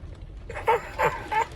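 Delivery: a high breathy laugh; airy high laugh. Real, not acted. Vlogger laughing at his God joke, airy high laugh. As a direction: giggle at your own punchline.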